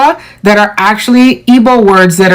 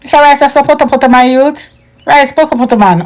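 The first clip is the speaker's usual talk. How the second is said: fast, higher Jamaican patois; 4 semitones higher, faster than usual. Shown as a demonstration. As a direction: rattle it off like a Jamaican youth. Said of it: "In Patois, we say poto poto".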